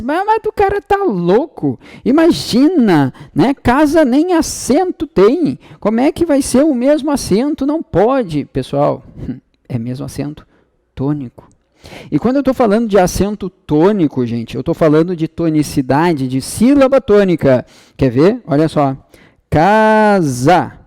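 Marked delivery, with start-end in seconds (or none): 0.0-8.4 high-pitched, mock-incredulous objection; aluno indignado imaginário. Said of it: "alguém vai dizer assim". 19.5-20.7 "Casa" with the first syllable held long; stress on first syllable. Shown as a demonstration. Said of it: "a sílaba tônica é o K".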